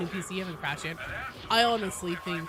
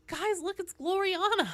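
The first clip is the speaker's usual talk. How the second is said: excited; 12 semitones higher than usual. Real, not acted. Spots a famous player in lobby.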